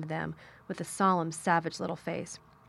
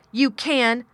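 loud, raised, forceful retort; shout; 5 semitones higher, 9 dB louder than usual. A narrator's reading. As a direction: shout back at the sobbing child. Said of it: "shouted Mary".

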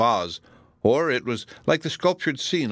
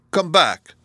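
raised, higher, urgent call; crying out; 7 semitones higher, 7 dB louder than usual. A narrator's reading. Donatello calls Miriam back as she withdraws, crying out. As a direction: call out loudly, pitched up. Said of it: "cried he".

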